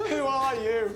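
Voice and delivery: high mock-posh question; imitating posh voice. Friend mocking his posh panic, imitating posh voice. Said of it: "you do a posh voice".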